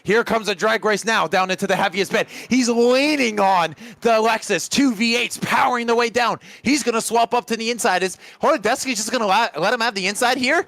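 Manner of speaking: fast, rising race commentary; excited play-by-play. Acted. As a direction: call the overtake live and urgent. Live call of overtaking battle.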